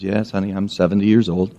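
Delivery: hushed, low granny voice; grandma. Acted. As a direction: admit it gently like a resigned grandma. Grandma answers, same words.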